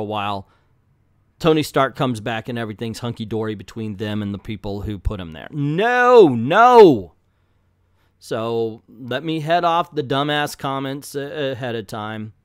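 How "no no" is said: loud, drawn-out, high-swooping no; exasperated shout. Real, not acted. Reviewer rejecting a consequence-free comeback, exasperated shout. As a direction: bellow the refusal, stretched out. Rejecting the story's easy reset.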